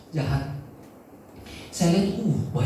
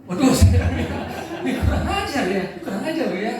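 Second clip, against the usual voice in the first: laughing disbelief; 5 semitones higher than usual. Real, not acted. Pastor laughing, retelling outrage.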